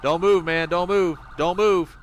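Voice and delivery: high, loud warning; police command shout. Acted. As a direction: hold him at gunpoint, yelling. Covering the suspect at gunpoint.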